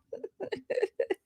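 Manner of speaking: high breathy burst of laughter; helpless giggle at the chat; real laughter. Real, not acted. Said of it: "I am actually being entertained".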